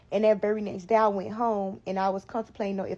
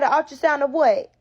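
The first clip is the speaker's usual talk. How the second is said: high, loud disbelieving snap; angry mom; 6 semitones higher, 7 dB louder than usual. Retold or imitated. Mom confronts pregnant daughter.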